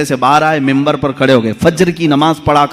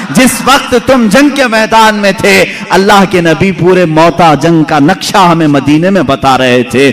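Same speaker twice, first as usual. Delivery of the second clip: fiery preaching. Acted. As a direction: preach it out to the whole gathering. Full-force preaching of the battle account. (जोशीला, ऊँची आवाज़ में तक़रीर)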